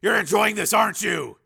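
high, loud incredulous call; shouted question. A narrator's reading. Steele challenging Ice under fire, high shout. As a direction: yell it over the chaos.

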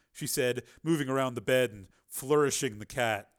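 ordinary delivery

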